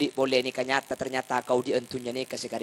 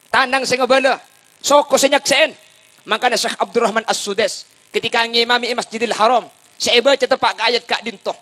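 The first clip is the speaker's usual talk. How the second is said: high, loud sermon delivery; raised, forceful proclamation; full-force preaching; 8 semitones higher, 9 dB louder than usual. Acted. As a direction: drive each phrase home to the congregation.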